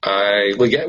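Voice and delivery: usual speaking voice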